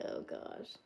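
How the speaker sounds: breathy whisper, worn out; overwhelmed whisper. Retold or imitated. Streamer overwhelmed by moving out tomorrow, weary whisper. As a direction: whisper it like you've given up. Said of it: "So I'm just like".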